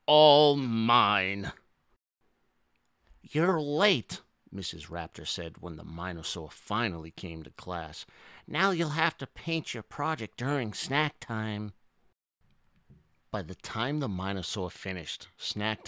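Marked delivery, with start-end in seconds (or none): 0.0-1.5 loud, drawn-out possessive gloat; sighing over hoarded treasure, at full voice; greedy sigh. A narrator's reading. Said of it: "hugged the toys and sighed". 3.2-4.2 higher-pitched teacher voice; lightly voiced reproving schoolteacher; scolding teacher. A narrator's reading. Teacher scolds a late pupil. 8.5-11.7 lightly voiced schoolteacher; scolding teacher. A narrator's reading. Teacher hands out a penalty.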